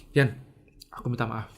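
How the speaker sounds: soft, low, humble apology; quiet apology. Retold or imitated. Acting out his apology to Yani. (permintaan maaf yang lirih dan rendah hati)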